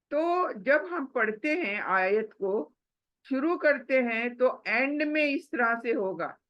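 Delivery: ordinary talk, nothing marked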